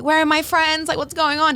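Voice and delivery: high-pitched retold freak-out; high, flustered imitation of panic; mock panic. Retelling panic in stranger's house.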